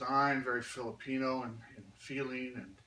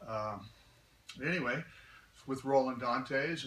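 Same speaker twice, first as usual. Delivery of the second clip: ordinary talk, nothing marked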